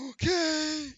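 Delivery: high, loud, gasped reply; raspy gasping barkeep voice; struggling to breathe. Acted. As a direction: wheeze it out high, badly short of air. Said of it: "struggling breathing".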